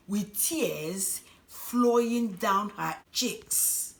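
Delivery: usual speaking voice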